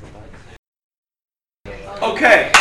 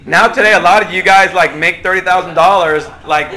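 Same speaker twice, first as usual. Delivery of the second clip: ordinary talk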